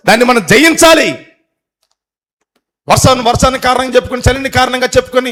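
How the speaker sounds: high, loud preaching, rising to a climax; preaching at full force. Acted. Preacher exhorts church not to skip services, loud and high. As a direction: hammer the point home to the congregation, loud and high. Preacher drives home the point.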